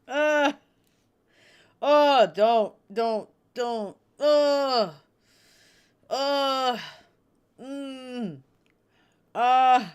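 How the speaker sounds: high, loud tearful groans; real crying. Real, not acted. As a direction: fight the sobs, moaning and pleading. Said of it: "get a few tears out".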